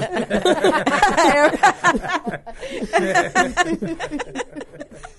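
high loud burst of laughter; cracking up at the joke; laughing. Real, not acted. Laughing after the robot bit.

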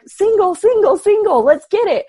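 high, loud eager chant of single; egging the trade on, pumped; excited cheer. Retold or imitated. Retold cheer to the host, high and loud excited.

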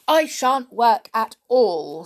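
loud, petulant pout; a spoilt girl's mild pouting defiance. Acted. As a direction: stamp your foot with the words.

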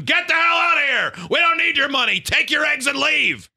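high-pitched shouting, imitating an irate boss; retold yell. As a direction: yell it high, throwing a customer out. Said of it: "yelled at him".

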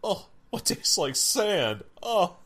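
high, slow mock disgust; disgusted drinker. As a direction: act out tasting something awful. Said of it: "all of a sudden it's like".

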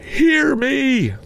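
very high spectral command; urgent ghost cry. A narrator's reading. Ghost demanding that Scrooge listen, urgent high cry. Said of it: "cried the ghost".

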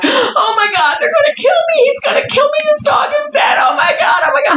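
high, wailing mock panic; panicked niece. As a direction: imitate a niece crying out in terror. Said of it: "I'm hearing my niece in the back".

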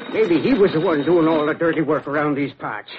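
normal talking voice

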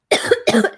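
loud real cough; harsh throat-clearing cough; chesty cough. Real, not acted. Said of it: "tickle in my throat".

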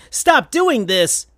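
mock exasperated shout; pleading shout. A narrator's reading. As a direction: beg him to stop. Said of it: "shouted Sonic".

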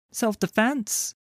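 high, slow, smiling; high-pitched feigned innocence; said with a smile. A narrator's reading. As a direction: draw it out sweetly with a smile. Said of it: "she said with a smile".

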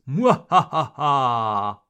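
evil villain cackle; spooky mock evil laugh; mustache twirling villain. Villain laugh after zombie joke.

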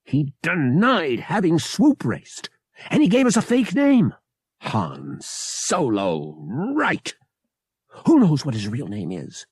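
high, heated accusation; shouting. A narrator's reading. Pavak about Han's fake name, mild shouting. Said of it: "Pavak shouted".

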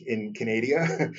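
hammed-up Canadian accent, Canada drawn out as "ca-NAY-dee-a"; slow mock-Canadian "ca-NAY-dee-a" for Canada; joking Canadian accent. Podcaster amusing himself while greeting Canadian listeners, joking Canadian accent. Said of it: "trying to do my best Canadian accent".

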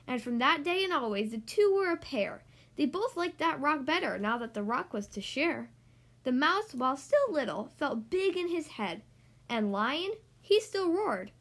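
ordinary delivery

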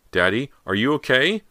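high, loud call rising at the end; shouting. A narrator's reading. Girl to father up in the attic, shouting. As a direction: shout up to someone out of sight. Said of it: "she shouted up the stairs".

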